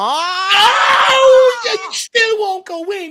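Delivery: high, loud cry of despair at the miss; anguished shout. Real, not acted. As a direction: wail it as the shot stays out. Near miss on goal.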